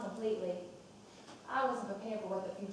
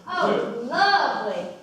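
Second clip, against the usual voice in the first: loud, higher gush of delight; delighted exclamation; 4 semitones higher, 12 dB louder, much slower than usual. Acted. Mother admiring the supper table, loud gushing delight. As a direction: beam over the table.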